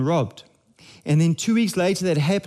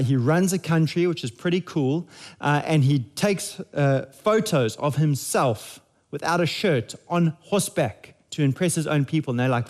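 ordinary talk, nothing marked

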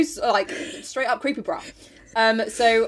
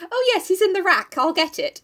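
high, flustered blurting; 9 semitones higher than usual. Acted. Meg answering Mister Brooks at the door, acted flustered. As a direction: play flustered, muddling the words in a high voice. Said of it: "Meg gets all flustered and she goes".

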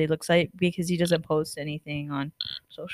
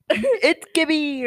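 Gibby catchphrase; 7 semitones higher, much slower than usual. Retold or imitated. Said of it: "what does he say?".

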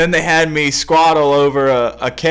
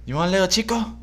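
mock hustler; 5 semitones higher, 5 dB quieter, faster than usual. Hustler bit continues.

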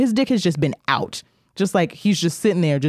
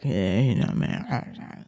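lower, softer, fading into a breathy mumble; unbothered man; 5 semitones lower, 6 dB quieter, much slower than usual. Retold or imitated. Old man brushing off the guard, lower and softer, trailing off. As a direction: shrug it off, trailing away.

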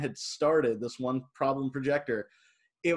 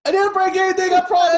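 high, loud defensive pleading; pleading innocence; 11 dB louder than usual. Retold or imitated. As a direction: protest your innocence. Pleading innocence to booth owner.

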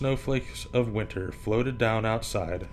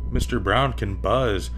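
plain reading voice

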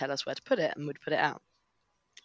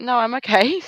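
breezy customer; 7 semitones higher, 11 dB louder than usual. Retold or imitated. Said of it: "she's like".

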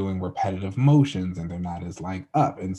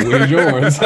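higher, louder, laughing through the line; laughing mock retort; 6 semitones higher, 12 dB louder than usual. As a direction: toss it back cheekily through laughter. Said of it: "he's like".

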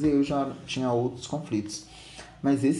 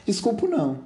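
hysterical. Acted. Daughter refusing her father's apology, mock-hysterical. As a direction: play the hysterical teenager, stretching into a squeal. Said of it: "ela já faz a histérica aqui".

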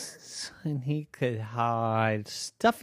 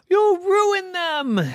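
higher, louder, slow wail of You'll ruin them; anguished protest; 6 dB louder, slower than usual. Acted. Boy pleading with mom over his precious covers, hammed-up wail. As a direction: drag it out in dramatic despair.

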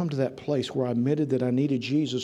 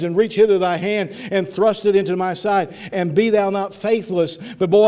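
loud, raised preaching voice; full-force preaching; 7 semitones higher, 9 dB louder than usual. Real, not acted. Preacher reading Thomas's answer to the church, loud fervent preaching. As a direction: drive the scripture home loudly.

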